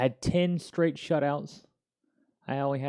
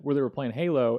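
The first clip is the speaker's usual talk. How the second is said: ordinary delivery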